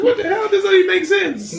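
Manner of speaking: very high, laughing disbelief. Retold or imitated. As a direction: squeal it, laughing in shock. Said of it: "I was like".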